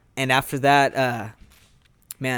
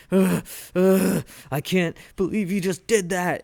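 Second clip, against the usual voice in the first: imitating Goku in a stunned daze, higher; dazed disbelief; 5 semitones higher than usual. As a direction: groan out like you can't take it in. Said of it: "kinda goes in this daze".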